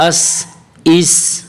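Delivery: slow, careful ث sound in اس; tongue-tip ث. Shown as a demonstration. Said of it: "اپنی زبان کو اوپر والے دانتوں کے نیچے ہلکا سا ٹچ".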